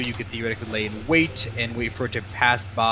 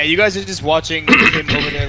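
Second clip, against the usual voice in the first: ordinary talk, nothing marked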